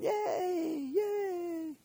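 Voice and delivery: high, thin, soft mock cheering; imitated crowd cheer. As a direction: draw out a small, high cheer like a distant crowd. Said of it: "all the others were around there going".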